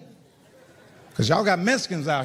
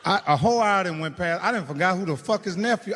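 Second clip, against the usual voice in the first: usual speaking voice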